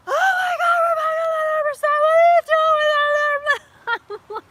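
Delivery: high, loud panicked shriek; screaming mom. Retold or imitated. Mom seeing a rattlesnake in her kid's hand, screaming shrill and loud. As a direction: shriek it like a terrified mother. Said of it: "she starts screaming at the top of her lungs".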